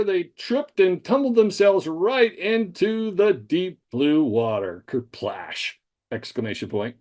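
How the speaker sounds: clear, measured dictation with spoken punctuation; dictating to voice typing. Shown as a demonstration. Teacher dictating a made-up story into voice typing, clear and deliberate. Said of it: "So here we go".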